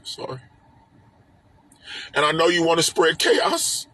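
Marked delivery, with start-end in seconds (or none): 0.0-0.4 choked up. Real, not acted. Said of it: "Sorry if I start crying".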